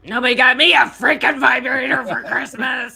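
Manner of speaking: hammed-up angry mama with a massager on the throat. Cohost complains about her Christmas gift on request, hammed-up angry mama voice. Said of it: "do the angry mama voice".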